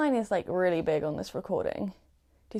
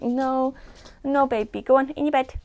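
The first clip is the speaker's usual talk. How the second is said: coaxing pet talk; 6 semitones higher than usual. Real, not acted. Owner sending squirming pet to its bed, high coaxing. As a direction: coax the pet away in a raised voice.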